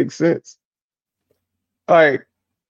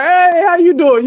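mock-bright hello, pitched up; chatty family member; 11 semitones higher than usual. Imitating a relative's greeting, cheery and higher. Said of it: "They be like".

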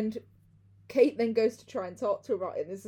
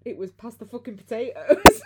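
mock posh outburst; 3 semitones higher, 14 dB louder than usual. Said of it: "Kate was like".

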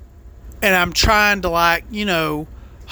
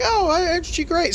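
much higher, gushing fake admiration; mock praise; 9 semitones higher than usual. Trucker mimicking virtue-signaling crowds, sarcastic gushing praise. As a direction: coo it sarcastically like a fawning crowd. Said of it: "just because we're virtue signaling, like".

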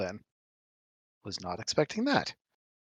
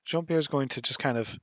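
usual speaking voice

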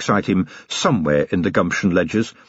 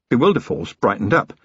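usual speaking voice

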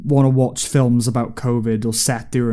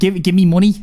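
higher-pitched put-on Scouse; Liverpool accent; 6 semitones higher, 3 dB louder than usual. Shown as a demonstration. Checking co-hosts' remark on the saying, Liverpool accent. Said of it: "Yeah, that's Liverpool".

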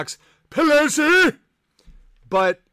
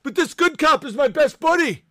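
mock gullible fan; 7 semitones higher than usual. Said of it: "Everyone's like".

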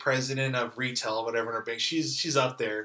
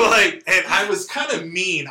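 ordinary talk

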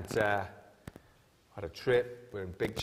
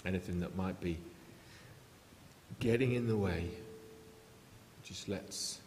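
quiet prayer; 2 semitones lower, 6 dB quieter than usual. Acted. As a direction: pray gently over the people, unhurried. Leads a closing prayer.

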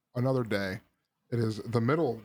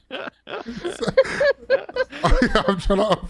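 breathless laughter, high pitched; trying to talk through a laugh; 9 dB louder, much slower than usual. Real, not acted.

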